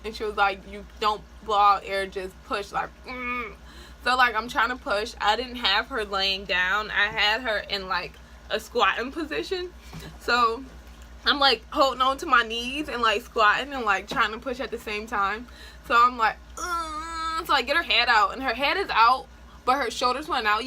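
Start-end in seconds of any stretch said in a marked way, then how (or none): 2.7-3.5 bearing down. Shown as a demonstration. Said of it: "Push like you have to poop". 16.6-17.5 straining grunt. Retold or imitated. Straining while pushing in labor.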